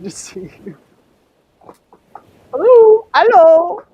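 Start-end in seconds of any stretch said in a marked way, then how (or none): 2.5-3.8 much higher, much louder hello; excited hello. Pretend. Greeting Marie, much higher and louder. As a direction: sing out a playful big hello.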